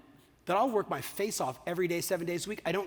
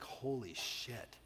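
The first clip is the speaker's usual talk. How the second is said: stunned awe; 5 semitones lower, 10 dB quieter, much slower than usual. Pretend. Future self stunned.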